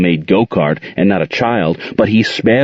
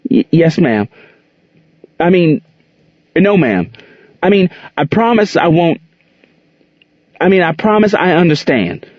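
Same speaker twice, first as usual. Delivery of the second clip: much higher, stumbling, frightened replies; flustered scared answers; 9 semitones higher than usual. A narrator's reading. Gerald answering furious Aunt Queen, high flustered fear. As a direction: trip over the answers, panicked.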